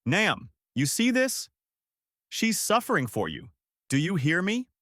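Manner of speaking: high-pitched call into a storm; restrained, anguished calling; mild shout. A narrator's reading. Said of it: "shouting into the storm".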